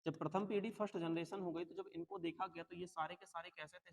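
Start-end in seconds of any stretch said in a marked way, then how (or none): none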